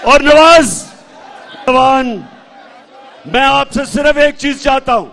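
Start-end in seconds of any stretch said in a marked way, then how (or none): 0.0-2.1 loud shout of the name; calling out. Real, not acted. Leader hails candidate at rally, loud call. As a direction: call the name out over the crowd.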